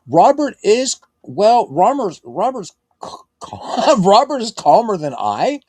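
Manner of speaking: high, loud incredulous squeal; squealing in playful disbelief; mock disbelief. Real, not acted. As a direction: act shocked at the claim. Reading chat claim in disbelief.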